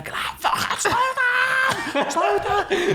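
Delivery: very high, slow, laughing mock scream; retelling the speedboat ride, a high laughing scream at the driver; retold scream. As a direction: stretch out a panicked yell through laughter. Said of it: "I was screaming".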